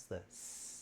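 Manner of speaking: S held as a long hiss; drawn-out s like a snake. Shown as a demonstration. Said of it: "sounds like a snake hissing".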